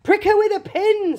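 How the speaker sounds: another father. Acted. Other father voiced.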